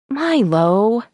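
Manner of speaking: high whining plea; whiny child voice. A narrator's reading. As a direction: whine it like a six-year-old. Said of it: "I sounded like a whiny six-year-old".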